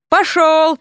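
forceful kick-out yell; sharp dismissal. Acted. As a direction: send him packing with a shout. Vasya kicks him off.

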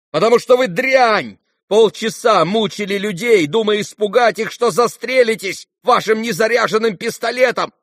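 high, loud, abusive rant; enraged tirade. A narrator's reading. Ganya's enraged tirade at Ippolit. (яростная брань на повышенных тонах)

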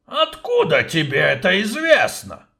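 high, loud suspicious dwarf voice. Acted. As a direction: question him like a wary dwarf. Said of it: "подозрительно осведомился гном".

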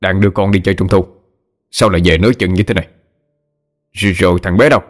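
quick, gruff acted scolding; cross husband, pace quickening; irritated scolding. A narrator's reading. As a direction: snap impatient questions at a wife. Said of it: "đẩy vợ ra rồi gắt lên".

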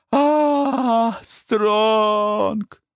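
high, whiny whimper; tearful high whine. A narrator's reading. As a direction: plead like a beaten child. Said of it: "захныкал он".

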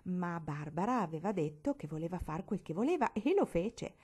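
ordinary delivery

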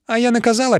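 high, knowing gibe; winking I-told-you-so. A narrator's reading. Sister gossiping about the lovers, winking I-told-you-so. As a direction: crow it to your sisters in a high voice. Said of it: "підморгнула сестра Мамілія".

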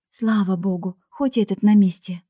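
plain reading voice

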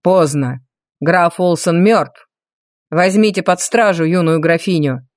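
low, cold commanding voice; stern mage. Acted. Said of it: "распорядился маг".

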